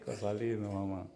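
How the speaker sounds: soft, low doting murmur; tender aside. Real, not acted. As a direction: coo it fondly like a proud father. Tender aside about his baby son.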